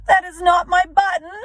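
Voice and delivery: high, loud wail; upset Toad wailing his button is lost. A narrator's reading. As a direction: wail it out in despair. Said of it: "wailed Toad".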